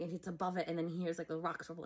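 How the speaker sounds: normal talking voice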